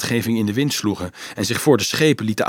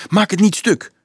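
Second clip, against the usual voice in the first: high, louder startled warning; alarmed cry; 9 semitones higher, 6 dB louder than usual. A narrator's reading. Leonardo warns Dolf prying the box open, alarmed. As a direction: call out in sudden fright. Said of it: "riep Leonardo verschrikt".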